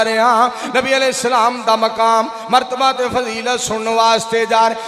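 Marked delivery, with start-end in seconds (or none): none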